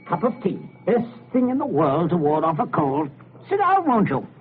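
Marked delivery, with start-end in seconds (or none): 3.5-4.3 much higher, breezy invitation; hearty host. Acted. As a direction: sound too cheerful. Overbright host.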